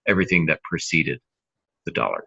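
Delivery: usual speaking voice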